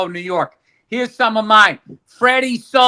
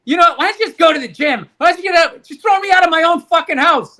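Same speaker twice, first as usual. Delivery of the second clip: higher, louder mimicry of his wife; hammed-up nagging wife; mock wife; 7 semitones higher, 4 dB louder than usual. Friend imitating wife ordering him out, higher and louder. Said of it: "I should just be like".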